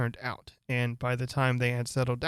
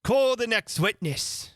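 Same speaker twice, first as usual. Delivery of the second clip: raised, slowed royal summons; loud call; 9 semitones higher, 4 dB louder, slower than usual. Acted. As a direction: call out across the court. King calling loudly, first time.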